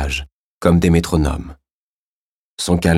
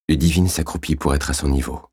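usual speaking voice

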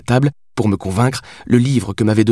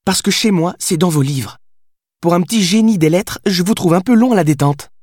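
put-on slightly raised voice of a sassy fictional girl; lightly voiced cheeky Billy, impatient; 9 semitones higher than usual. A narrator's reading. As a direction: explain it with a touch of impatience.

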